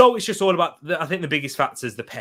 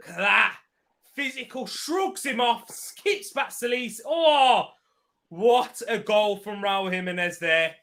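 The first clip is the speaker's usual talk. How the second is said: excited commentary; 9 semitones higher than usual. Real, not acted. Replay commentary continues.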